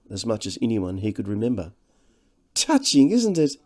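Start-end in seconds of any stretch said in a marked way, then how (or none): 2.5-3.6 high, affected aside; mock the sentiment lightly; arch mockery. A narrator's reading. Devine mocks the old-school reunion.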